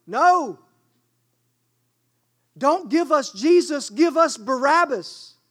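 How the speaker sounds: loud, high crowd demand; crowd shouting. Retold or imitated. Preacher voicing the mob demanding Barabbas, loud shout.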